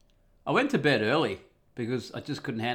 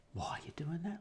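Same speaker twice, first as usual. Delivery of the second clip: high whispered question to himself; whispered musing; 8 semitones higher, 10 dB quieter than usual. Retold or imitated. Wondering to himself about his wife, whispered. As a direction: whisper it like a private suspicion. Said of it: "I wonder".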